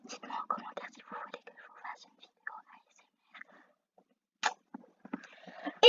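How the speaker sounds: soft breathy whisper right into the mic; ASMR whisper. Shown as a demonstration. Whispering to viewers to ask for comments. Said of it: "j'étais en train de chuchoter".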